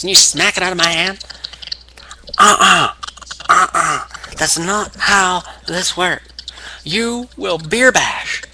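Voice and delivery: high, indignant mock wrestling promo; Stone Cold Steve Austin. Wrestler on the mic to a rival who refused his beer, mock displeased. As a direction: imitate a wrestler laying down the law. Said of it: "promo where Austin, you know, voices displeasure".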